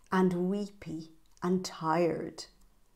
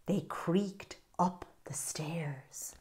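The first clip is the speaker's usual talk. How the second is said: slow, hushed whisper; 9 dB quieter, slower than usual. Acted. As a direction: creep the words out in a whisper. Creeping, whispered.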